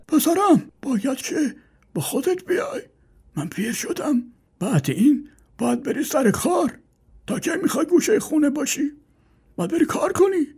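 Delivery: put-on high old man's voice pleading; old father tells lazy son to work. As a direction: urge the son like a frail old man.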